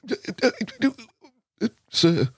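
high, stammering awe before a legend; awestruck stammer. Retold or imitated. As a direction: stutter out sir like a starstruck kid. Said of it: "you were like".

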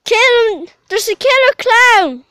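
high, very loud shout; mock-panicked scream; mock alarm yell. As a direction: raise the alarm at the top of your voice. Mock alarm at pretend clown.

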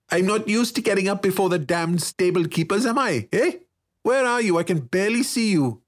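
put-on high, grumbling nasal boy; irritable retort. A narrator's reading. Sleepy-early boy grumbling to his friend, irritable. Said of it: "Yalaric shot back".

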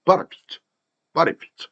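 "but if it" bundled as one word, "ba-re-pit"; quick, light linking. Shown as a demonstration. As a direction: run "but if it" together lightly. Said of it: "한 묶음으로 굉장히 빠르고 가볍게".

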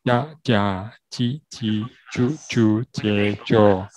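slow 呀呀叽叽啾啾接着, each syllable separate; syllable drill. Shown as a demonstration. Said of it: "第三".

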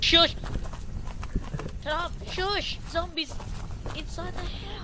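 high, urgent hushed shout; urgent shushing. Real, not acted. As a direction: hush them urgently. Hushing partner near zombies.

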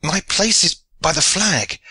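high, impassioned protest; insistent high-pitched cry; Allen's cry. A narrator's reading. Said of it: "cried Allen".

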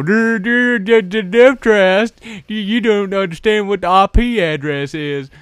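high, loud dopey caricature; mock know-it-all viewer. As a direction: stammer it as a mocking impression. Mimics a correcting viewer.

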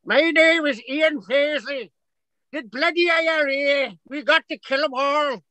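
a hint of Ian Paisley's Ulster bark; Ian Paisley impression. Comic host doing Ian Paisley for friends, Northern Irish accent. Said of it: "or you could sound like this".

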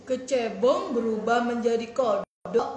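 lightly voiced gardener. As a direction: explain it like an old gardener. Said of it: "kata Pak tukang kebun".

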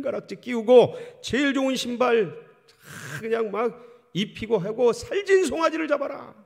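joyful father. Acted. Father orders servants to celebrate son's return, jubilant high shouting. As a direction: shout for joy, a father whose lost son came home. Father welcomes lost son home.

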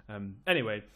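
usual speaking voice

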